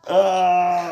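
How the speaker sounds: loud gasp of pain. Real, not acted. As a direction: catch breath after being winded. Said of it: "You just, like, winded me".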